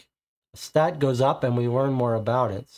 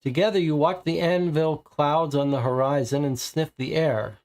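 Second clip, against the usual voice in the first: ordinary delivery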